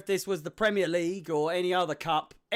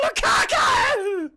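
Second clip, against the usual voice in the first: screaming Lukaku; 7 dB louder, much slower than usual. Shown as a demonstration. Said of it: "I can't scream Lukaku's name without screaming".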